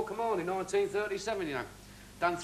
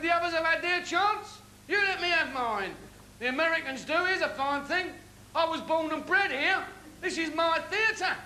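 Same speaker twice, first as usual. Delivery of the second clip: indignant retort; 9 semitones higher than usual. Retold or imitated. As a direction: stand your ground and throw it back at him. Heated retort to stage manager.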